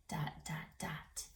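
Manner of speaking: hushed whisper on dot, dot, dot; suspense whisper. Shown as a demonstration. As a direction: drop to a secretive whisper, drawing kids in. Reading ellipsis for suspense.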